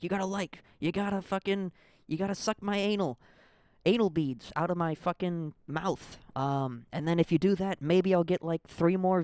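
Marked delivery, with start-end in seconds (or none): 0.0-6.2 pushy comedian-podcaster, pitched higher; aggressive content creator. Retold or imitated. Mocking pushy podcasters who beg listeners, higher and aggressive. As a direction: hammer the audience with crude demands. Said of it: "the aggressiveness to be like".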